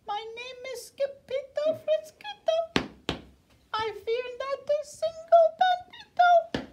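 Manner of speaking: plain reading voice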